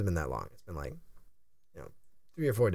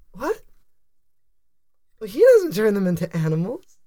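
giggly voice; 6 semitones higher, 7 dB louder than usual. Acted. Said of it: "And she goes".

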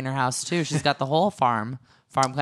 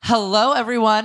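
host greeting; 6 semitones higher, 7 dB louder than usual. Real, not acted. Podcast show open.

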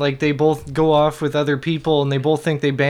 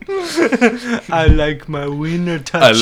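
put-on silly childlike voice; 7 dB louder, much slower than usual.

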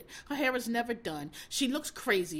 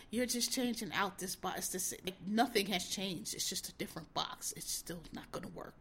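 dropping to a near whisper, softer and lower; hushed retelling; 4 semitones lower, 11 dB quieter than usual. Retold or imitated. Grandson quietly telling grandfather it won't work. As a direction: confide it under your breath.

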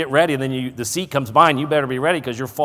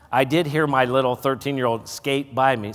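normal talking voice

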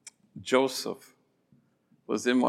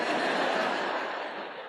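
soft laugh; 7 dB quieter than usual. Real, not acted. Laughing at his own story.

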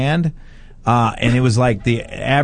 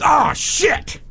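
high alarmed yelp; mock panic; 13 semitones higher than usual. Friend in the car realizing something, high alarmed yelp. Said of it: "he goes".

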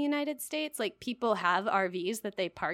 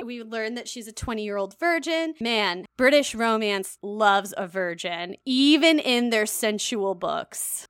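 normal talking voice

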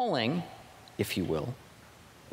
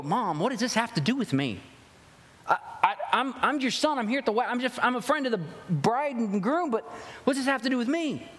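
higher-pitched, acted protest to mom; protesting son; 7 semitones higher than usual. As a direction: plead your case like a son put on the spot. Imagined son protesting to his mother.

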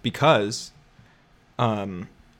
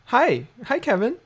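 put-on high, eager young voice; younger self voice; 11 semitones higher, 4 dB louder than usual. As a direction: play your younger, hopeful self. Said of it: "We're so young and optimistic".

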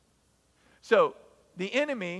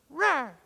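weak roar; 8 semitones higher, 4 dB louder than usual. Retold or imitated. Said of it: "what we're gonna sound like".

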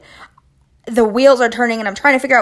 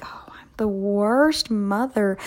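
hushed, slow self-reproach; quiet, drawn-out mock guilt; guilty thought; 5 dB quieter, much slower than usual. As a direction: breathe it out softly like a remorseful aside to yourself. Voices her own guilty thought.

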